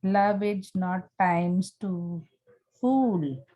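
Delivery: measured classroom reading of a sonnet; poem recitation. Acted. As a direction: read the verse aloud, slowing and pausing between phrases toward the end. Reads a sonnet aloud.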